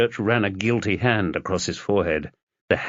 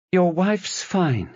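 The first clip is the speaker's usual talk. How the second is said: nurse voice; 10 semitones higher, slower than usual. Acted. Nurse reassures husband at hospital.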